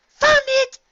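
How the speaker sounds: very high, loud cry; triumphant shout. A narrator's reading. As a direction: call out the find. Triumphant call.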